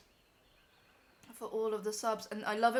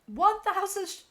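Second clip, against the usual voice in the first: high, loud burst of surprise; astonished; 10 semitones higher, 10 dB louder than usual. Real, not acted. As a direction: blurt it out in amazement. Startled by the live viewer count.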